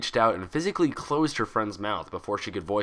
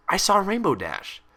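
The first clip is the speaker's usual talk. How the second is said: usual speaking voice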